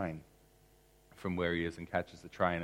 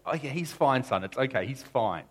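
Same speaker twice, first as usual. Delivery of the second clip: higher and louder, eagerly reassuring; eager reassurance; 7 semitones higher, 7 dB louder than usual. Retold or imitated. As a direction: mom rushing to calm her son, high and loud. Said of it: "She's like".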